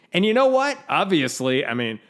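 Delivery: put-on quoting a pastor in an Oklahoma accent. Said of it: "Here's what he says at the end".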